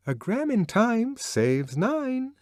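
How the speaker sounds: high, slow, singsong recital; Lenina reciting a saying. A narrator's reading. Lenina to Bernard, reciting a high singsong motto. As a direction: chirp it like a learned lesson. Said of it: "producing a bright treasure of sleep-taught wisdom".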